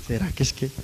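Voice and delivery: a quick airy laugh that spills into the words; breathy laugh. Real, not acted. Man in a wheelchair laughing at his own doubt, airy chuckle. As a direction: laugh it off, half amused at the doubt.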